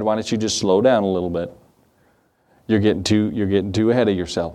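low-pitched cautioning voice; imitated elder's counsel. Senior pastors cautioning the younger preacher, low and restraining. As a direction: rein him in, keeping the pitch low.

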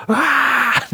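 high-pitched mock child scream; long high imitation squeal; imitated kid's scream. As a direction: screech like a kid having a tantrum, played for laughs. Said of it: "this kid's screaming".

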